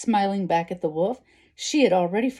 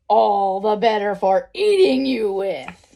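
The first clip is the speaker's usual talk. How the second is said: louder, triumphant wolf reveal; menacing wolf; 3 semitones higher, 8 dB louder, slower than usual. A narrator's reading. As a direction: pounce on the line as the hungry wolf. Wolf reveals he will eat her.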